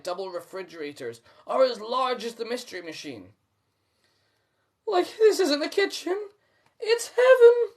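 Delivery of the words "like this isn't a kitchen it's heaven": very high, loud rapturous Shaggy; Shaggy proclaiming. A narrator's reading. Shaggy proclaiming to Scooby, very high and loud. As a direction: swoon over the kitchen like it's heaven. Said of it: "Shaggy proclaims".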